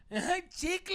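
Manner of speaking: high-pitched mock yell of a drunk woman; drunk girl yelling. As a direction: shriek the word like a drunk heckler. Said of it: "I just hear this girl go".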